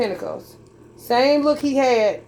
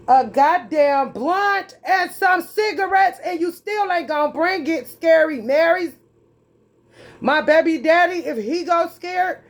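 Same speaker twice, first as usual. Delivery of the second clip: exasperated shout; 6 semitones higher than usual. Real, not acted. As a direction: yell it out in exasperation. Ranting about what she wants.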